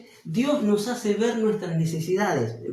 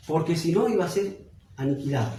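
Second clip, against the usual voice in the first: ordinary talk, nothing marked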